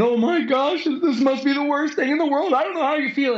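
high, put-on gushing consoler; mock sympathy. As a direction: gush pity like an overeager friend. Dan consoling friend, mocked.

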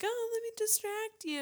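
imitated cooing distraction; luring the horse. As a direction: coax an animal away in a high voice. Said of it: "and was like".

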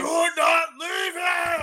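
high, slow furious scream. Acted. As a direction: scream it at them.

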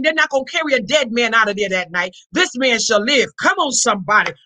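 usual speaking voice

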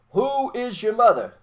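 high, loud, slow call of a teacher; teacher's call. Retold or imitated. Soviet teacher quizzing schoolkids, high and loud. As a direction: ring out the question to the class. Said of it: "they would say".

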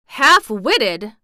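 loud indignant outburst; angrily affronted question; angry. A narrator's reading. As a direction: throw it out loud in offended anger. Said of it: "said Colin angrily".

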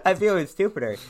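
talking through a laugh. Real, not acted. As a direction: laugh as you say it.

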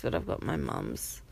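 ordinary talk

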